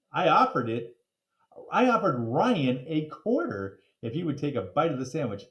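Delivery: ordinary delivery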